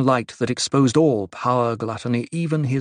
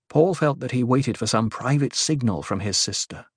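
plain reading voice

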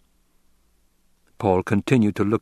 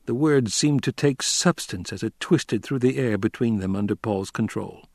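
ordinary delivery

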